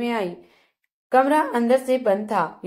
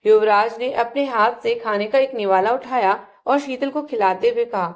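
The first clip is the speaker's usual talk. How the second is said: ordinary delivery, nothing marked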